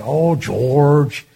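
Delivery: a light hint of the senator's fond greeting; imitating Kerr. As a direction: lean in and greet him like an old friend. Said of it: "he'd just talk to you like that".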